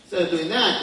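ordinary talk, nothing marked

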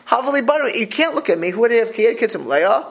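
ordinary talk

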